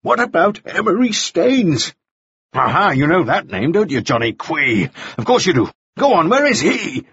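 high, insistent goading questions; desperate fury. A narrator's reading. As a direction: badger him with rising desperation. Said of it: "His fury was acquiring a desperate edge".

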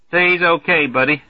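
put-on high, loud, hearty good-ol'-boy; brash Major Marvey. Marvey hollering a greeting to the newcomer, brash and hearty. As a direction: boom the welcome like a glad-hander.

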